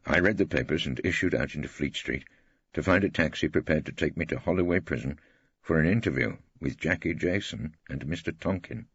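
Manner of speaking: ordinary delivery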